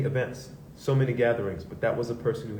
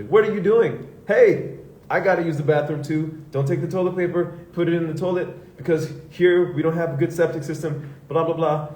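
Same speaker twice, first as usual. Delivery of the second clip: much higher, louder nagging yell; impatient knocker; 6 semitones higher, 6 dB louder than usual. Retold or imitated. Housemate at the bathroom door, nagging loudly and impatiently. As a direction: nag through the bathroom door.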